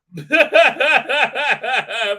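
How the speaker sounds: loud, high cackle; long rapid mocking laugh. Real, not acted. Streamer laughing at the show's ratings, loud cackle.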